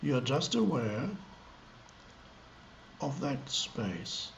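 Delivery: halting, spacious delivery with lengthy pauses mid-sentence; meditative gaps. Shown as a demonstration. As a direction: leave long silences between short phrases. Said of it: "small gaps between words as I talk".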